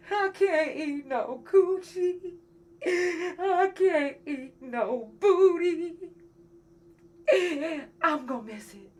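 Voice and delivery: slow, high, hammed-up fake wail; mock sobbing. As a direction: ham up a crying fit over the diet. Joking lament over surgery diet.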